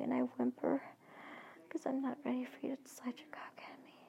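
usual speaking voice

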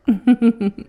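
a short, bright burst of laughter; loud laugh. Acted. Bartender laughs loudly while teasing the girl. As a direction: laugh at your own teasing.

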